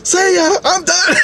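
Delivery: very high squealed goodbye, breaking into laughter; tearful panic. Retold or imitated. Crying Dawn hugs her and flees, tearful panic. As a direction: imitate a hammed-up weepy getaway. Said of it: "Dawn started fucking crying".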